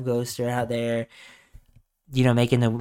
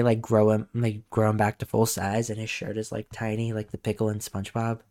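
ordinary talk, nothing marked

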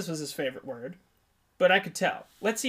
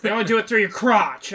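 higher, loud mock insistence; loud insistent patient; 4 semitones higher, 8 dB louder than usual. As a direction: imitate a man hammering his favorite word. Patient repeats his favorite word.

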